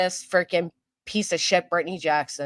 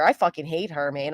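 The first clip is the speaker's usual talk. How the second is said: normal talking voice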